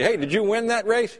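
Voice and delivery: cheerful question. Retold or imitated. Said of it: "And you go up and say".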